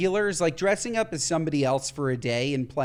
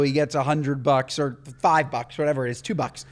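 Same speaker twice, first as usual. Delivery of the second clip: normal talking voice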